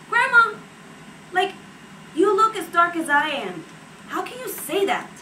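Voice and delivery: high, exasperated retold protest; indignant protest.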